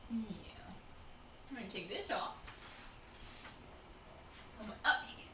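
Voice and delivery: usual speaking voice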